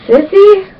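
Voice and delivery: high, small sleepy voice; weak little sister. Retold or imitated. Little sister waking from a coma calls to her sister, high and faint. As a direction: wake up groggy and call for your big sister. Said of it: "she looked at me through, like, squinty eyes and she went".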